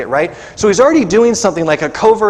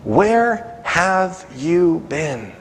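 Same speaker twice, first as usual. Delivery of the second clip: very slow, softer wounded question; hurt plea; 4 dB quieter, much slower than usual. Acted. Gideon asking God where he was, slow hurt plea. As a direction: let the question ache. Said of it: "And Gideon's like".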